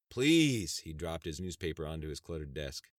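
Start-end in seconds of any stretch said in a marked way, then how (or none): 0.1-0.8 loud, high, exasperated plea. Acted. Sheriff fed up with his secretary's warning, exasperated. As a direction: burst out in exasperation.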